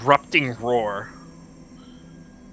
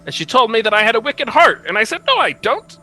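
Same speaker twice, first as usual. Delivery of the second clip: higher, animated storytelling prince; prince character voice; 8 semitones higher, 4 dB louder than usual. Acted. Prince recounting his meeting with the witch, high animated voice.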